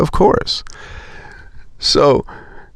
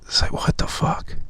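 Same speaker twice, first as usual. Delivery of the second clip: soft disbelieving whisper; 7 dB quieter than usual. Real, not acted. Whispers to the viewer, baffled by rich office coworkers.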